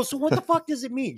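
high-pitched mock exasperation; retold baffled protest; exasperated. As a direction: demand an explanation, acted out. Reenacting argument over the word.

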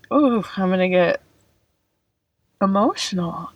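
real welling up, halting mid-phrase; getting emotional. Real, not acted. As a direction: pause as tears start to rise. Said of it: "I'm tearing up because".

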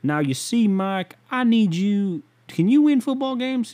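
high, slow, strained old-man drawl, badly faked; bad Jerry Jones impression. Football owner grilling his coach, in a badly faked high old voice. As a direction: ham up a creaky old team owner, pitch climbing high. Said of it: "I can't do a good Jerry Jones".

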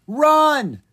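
high, loud urgent yell. Acted. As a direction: shout at him to run. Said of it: "Ella yelled".

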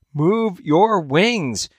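high, drawn-out, slow plea; slow urgent call. A narrator's reading. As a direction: stretch each word out to reach him. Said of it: "her mate followed".

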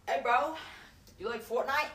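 hammed-up hustler calling out; street hawker. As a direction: imitate a pushy street seller trying to relate. Acting out a street album seller.

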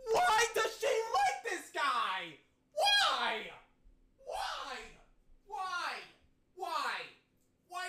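high, laughing despair; mock anguish. Real, not acted. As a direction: wail it in hammed-up agony. Anguished rant over singer.

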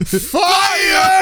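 very high hammed-up cry; high playful shriek through laughter; mock dramatic cry. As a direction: wail it like a hammy movie line. Joins in acting the moment.

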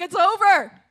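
a high, loud wail of mock despair. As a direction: wail it out like it's the end of the world. Recalling crying in brother's bed.

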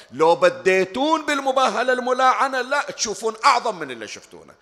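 loud, driving sermon voice, quickened at the end; full-force preaching. Acted. Preacher warns the congregation of the Prophet's wrath, booming. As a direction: thunder the Prophet's warning at the congregation.

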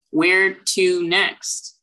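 drawn-out, very slow question. Acted. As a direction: stretch each word slowly. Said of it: "lean over the table and say".

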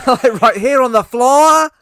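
put-on very loud, slow, high-pitched Queens accent; exaggerated Queens accent. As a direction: stretch "floor" out in a hammed-up Queens accent. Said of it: "try and do the accent".

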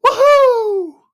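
very high, loud, drawn-out whoop; whooping "woohoo". Retold or imitated. The man's heard instruction from God, whooping cheer. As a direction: let out a soaring whoop. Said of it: "And he goes".

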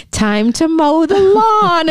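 put-on high, slow, sweetly menacing child; creepy child voice. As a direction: stretch each word like a killer kid. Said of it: "tongue-in-cheek kind of committed".

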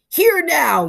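loud, high-pitched challenge; yelled. A narrator's reading. Hunchback confronting the boy at the machine, yelling. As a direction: yell to stop the intruder. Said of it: "yelled the hunchback".

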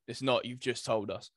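ordinary talk

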